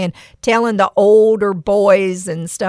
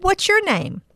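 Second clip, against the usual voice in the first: friendly question; 10 semitones higher than usual. Retold or imitated. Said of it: "And I said".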